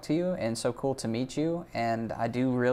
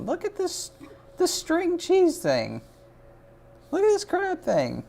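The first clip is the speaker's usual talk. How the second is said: much higher, squeaky excited voice; high squeal over the crab; squealing delight; 3 dB louder than usual. Real, not acted. Squealing over cute art.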